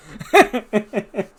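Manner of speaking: a burst of high laughter; loud laugh. Real, not acted. Laughing loudly at his own word mix-up.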